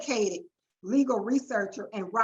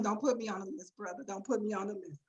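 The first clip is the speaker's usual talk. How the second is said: hushed, softer plea to a colleague; half-joking, quietly begging him off the list; mock plea; 4 semitones lower, 10 dB quieter, faster than usual. Host quietly begging her co-host to keep her off the list.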